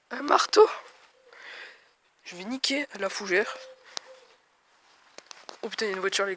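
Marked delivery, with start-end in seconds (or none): 0.1-0.7 excited. Real, not acted. Whispered reveal, then repeated aloud.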